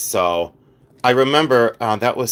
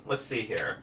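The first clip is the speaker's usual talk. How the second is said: ordinary delivery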